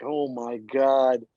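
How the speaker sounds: slow, stunned and breathless; awestruck oh my God. Retold or imitated. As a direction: let it out slowly in disbelief. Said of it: "And I was just like".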